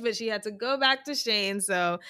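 ordinary delivery

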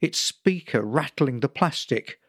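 usual speaking voice

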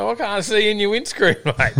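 high, comic protest; exasperated official. Acted. Official arguing with driver over tinted windscreen, laughing exasperation. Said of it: "I'm like".

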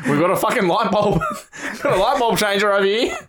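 high, hammed-up busybody; put-on snitch voice. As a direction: report it like a tattling neighbour. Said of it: "both putting on this accent".

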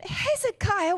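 high, pleading call of the name, a touch of a prophet's dismay; alarmed prophet. Retold or imitated. Prophet Isaiah rebuking the proud king, high alarmed call. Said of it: "Isaiah came back and went".

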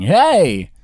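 loud high game-show shout; announcer voice. Pretend. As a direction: bark it like a hype announcer. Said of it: "That's a very specific voice".

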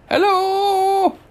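high, loud call over water; yelling hello. A narrator's reading. Old god Ra yells across the river in a high, loud call. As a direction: hail them from far across the river. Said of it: "he yelled across the river".

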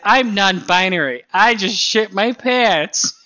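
high, loud mocking kid voice; mock little kid. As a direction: blurt it like a small child. Mimicking a small child announcing.

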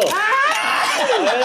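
a ver, agudo y gritado entre risas; grítalo riendo al tomar la foto; grito juguetón. Real, not acted. (gritando entre risas para que posen)

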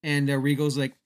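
usual speaking voice

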